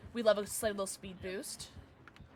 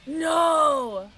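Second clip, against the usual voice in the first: loud, higher cry of no; dismayed shout; 6 semitones higher, 11 dB louder than usual. Real, not acted. Player watching the game go wrong, dismayed shout. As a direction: yell out in protest.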